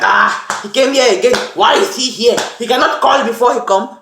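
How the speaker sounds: annoyed host. Retold or imitated. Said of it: "You get mad like".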